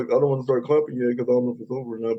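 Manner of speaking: ordinary delivery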